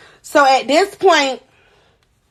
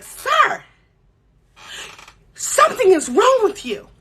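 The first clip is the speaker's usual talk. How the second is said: mock disbelief; 8 semitones higher than usual. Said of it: "I'm looking like".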